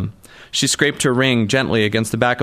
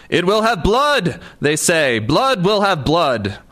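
high, slow theatrical Shakespeare declaiming; hammed-up tragic actor, much higher; doing Macbeth; 7 semitones higher, slower than usual. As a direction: hold up a cupped hand and declaim it. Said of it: "He's doing Macbeth".

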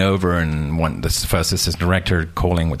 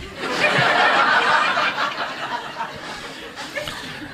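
long high wheezing laugh; 4 dB quieter than usual. Real, not acted. Laughs at nurse remark.

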